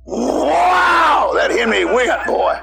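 high excited shout ending in a laugh. Real, not acted. Car racing away from danger.

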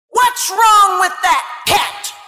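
slow, high angry yell at the cat; furious shout. Retold or imitated. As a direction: bellow it out, furious at the crying cat. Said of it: "Daniel was furious".

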